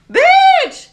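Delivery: very high, loud shouted curse; yelled swear. Retold or imitated. Bible college girl swearing in the car, screamed. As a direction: belt it out like breaking a rule. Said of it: "it was like".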